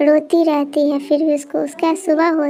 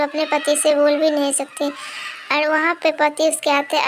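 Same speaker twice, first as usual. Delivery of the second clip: ordinary delivery, nothing marked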